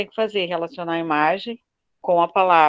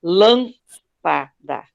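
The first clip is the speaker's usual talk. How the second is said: lâmpada said very slowly, stress on lâm; slow teacher demo; much slower than usual. Shown as a demonstration. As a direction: stretch lâmpada out, lean on lâm. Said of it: "ó".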